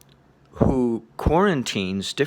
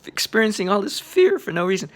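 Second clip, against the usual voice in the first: sudden high squeal of alarm, far higher; startled panic; 3 dB louder than usual. Retold or imitated. Meditator retelling her sudden fear on the course, high panicky squeal. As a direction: yelp it out like a fright from nowhere. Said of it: "And I go".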